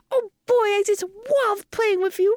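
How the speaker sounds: put-on slow, very high baby voice; Franklin, delighted. Shown as a demonstration. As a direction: gush like a thrilled baby, drawn out and high. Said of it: "Or like".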